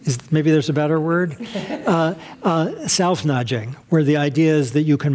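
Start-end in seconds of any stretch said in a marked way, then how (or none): none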